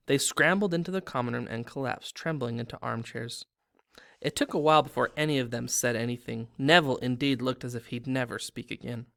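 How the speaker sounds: usual speaking voice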